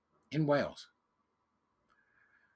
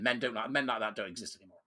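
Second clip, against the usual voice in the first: normal talking voice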